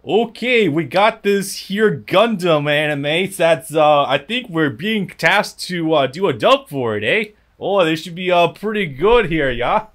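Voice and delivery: put-on hammy Canadian accent with eh. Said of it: "stereotypical Canadian accents".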